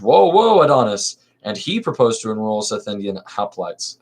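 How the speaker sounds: lively theatrical reading of a verse comedy; dramatic play reading. Acted. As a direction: read the chorus lines with stage energy. Reads magistrate's speech.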